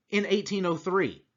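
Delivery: ordinary talk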